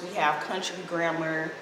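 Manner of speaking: ordinary talk, nothing marked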